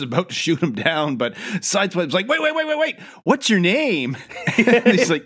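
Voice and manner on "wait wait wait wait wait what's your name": panicked. Retold or imitated. Panicked retold plea.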